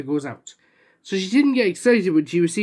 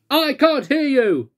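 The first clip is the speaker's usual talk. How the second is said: slow, loud and higher; booming; 7 semitones higher, 4 dB louder, slower than usual. Acted. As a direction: boom it across the shop. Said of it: "boomed Little Miss Spotty".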